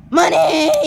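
loud, drawn-out cry; hammed-up money cheer; greedy shout. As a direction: yell it with greedy glee. Gleeful cry about money.

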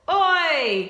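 high oi, falling away; playful holler for children to copy; calling out. Shown as a demonstration. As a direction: call it across to the door. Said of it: "Can you say oi to the door?".

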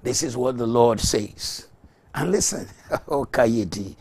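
loud fiery sermon proclamation; forceful pulpit preaching; preaching at full force. Real, not acted. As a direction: thunder the scripture to the congregation.

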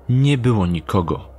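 ordinary talk, nothing marked

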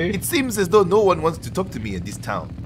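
badly faked mixed foreign accent; Italian-Nigerian accent. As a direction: complain in a muddled put-on foreign accent. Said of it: "It's like a mixture of, like, Italian and, like, Nigerian".

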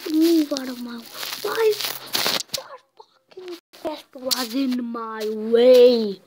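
loud gamer rage; real, full-volume exasperated outburst; furious shout. Real, not acted. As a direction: yell at the player blocking your way. Rages at player blocking them.

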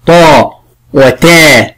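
طا heavy and emphatic, then تا light and thin; ta versus taa. Shown as a demonstration. Teacher contrasting طا and تا for the pupil, emphatic then light. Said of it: "أما حرف الطاء مفخم".

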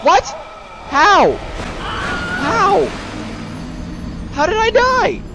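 high, loud yelps of disbelief; shocked disbelief. Real, not acted. Player reacting to sudden death in game, high-pitched shock. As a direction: cry out, stunned and indignant.

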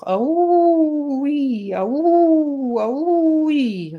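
long, high, loud wailing howl; wolf howl. A narrator's reading. Wolf caught in a trap cries out, high howling. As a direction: howl in pain like a trapped wolf. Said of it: "rief der Wolf".

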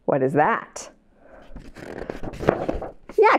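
ordinary talk, nothing marked

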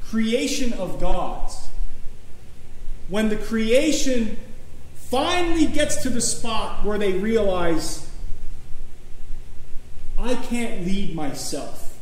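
loud, slow, building preacher's cry; preaching at full force. Acted. Pastor urging congregation that surrender comes first, rising to a loud cry. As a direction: drive it home to the congregation.

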